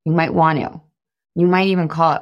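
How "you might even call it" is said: usual speaking voice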